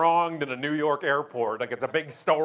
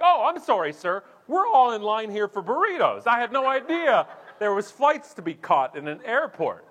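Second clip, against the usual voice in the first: loud, high mock apology; sarcastic telling-off; 7 semitones higher, 8 dB louder than usual. Traveller telling off a line-jumper in airport security, loud sarcasm. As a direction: tell them off through exaggerated fake politeness. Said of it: "they have no idea they're being told off".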